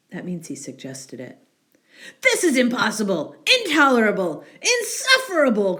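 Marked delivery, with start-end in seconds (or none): 2.2-5.8 louder, fast, rising fury; furious bear. Acted. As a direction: bear at his wits' end. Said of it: "cried Bear".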